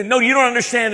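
high, loud, exasperated retort; mock an irritated phone scammer; angry scammer. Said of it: "they're starting to get angry".

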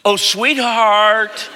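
high, very slow singsong call; imitating a sugary parent; sweet calling. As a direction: stretch it out sweetly, cooing. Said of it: "house and said".

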